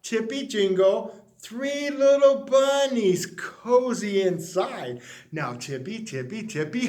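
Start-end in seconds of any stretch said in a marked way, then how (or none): 5.3-6.9 much softer, lower hush; like sneaking past a sleeping man. A narrator's reading. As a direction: tiptoe the words in a hush. Bunnies sneaking, hushed.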